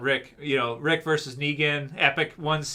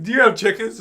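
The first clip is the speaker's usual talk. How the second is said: stern cop at the door; 5 semitones higher, 5 dB louder, faster than usual. Retold or imitated. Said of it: "It's like".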